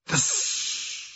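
high, breathless hiss from a broken golem; broken clay golem gasping out its last; ruined golem hiss. Acted. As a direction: force out a voiceless hiss, barely a word. Dying golem hisses through damage.

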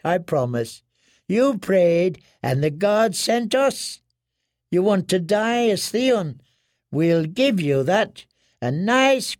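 high, slow, soothing woman's voice promising death; comforting him towards his death; sweetly menacing washerwoman. Acted.

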